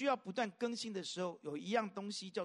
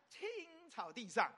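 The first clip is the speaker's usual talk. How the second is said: 青 drawn out long, higher; stress on 青; 4 semitones higher, much slower than usual. Shown as a demonstration. Preacher showing how stress changes a verse, stress on 青. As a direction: stretch 青 slowly before 草地上. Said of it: "用不同的重音去读".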